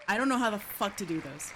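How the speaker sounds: ordinary delivery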